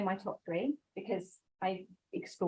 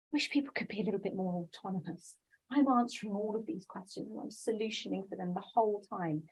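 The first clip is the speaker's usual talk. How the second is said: quiet grumble of a tired school leader; complaining school leader; 7 dB quieter than usual. Retold or imitated. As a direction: sigh it out like a weary head teacher.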